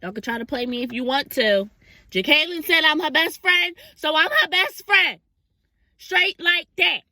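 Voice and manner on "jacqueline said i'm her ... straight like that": high, loud, slow boast; proud proclamation. Real, not acted. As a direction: declare it proudly, drawn out. Proclaiming best friend status.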